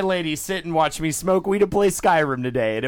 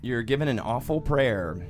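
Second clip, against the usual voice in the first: normal talking voice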